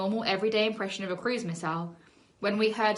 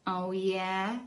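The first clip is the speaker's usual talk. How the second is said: sneering; 4 dB quieter than usual. A narrator's reading. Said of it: "he sneered".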